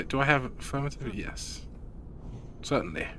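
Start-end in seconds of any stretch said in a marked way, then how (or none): 0.0-1.7 hushed. Real, not acted. Quiet comms in raid.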